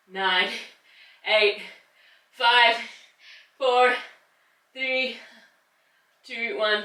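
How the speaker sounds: rising, cheering count; building countdown. Real, not acted. Counting down the hold.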